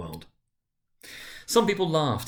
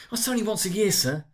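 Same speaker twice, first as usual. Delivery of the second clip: much higher, anxious pleading clerk; 10 semitones higher than usual. Acted. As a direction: beg the boss to let it slide. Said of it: "pleaded Bob".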